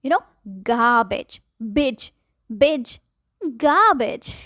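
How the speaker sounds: गार्बेज as gabij, r silent. Shown as a demonstration. Teacher drills the silent r. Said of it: "आर silent होता है".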